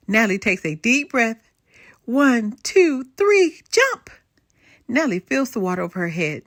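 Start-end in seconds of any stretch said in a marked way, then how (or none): 2.0-4.1 rising louder and higher to jump; excited countdown. Acted. Girl counts herself into the pool, building excitement.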